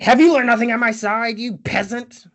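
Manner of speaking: high, loud pompous outburst; hammed-up haughty outrage, voice pushed up; mock indignant scolding. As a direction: imitate a knight berating his squire. Don Quixote scolding in retelling.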